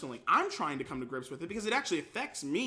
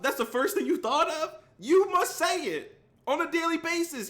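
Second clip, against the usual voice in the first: incredulous; 12 semitones higher, 7 dB louder than usual. Real, not acted. Incredulous at a chat comment.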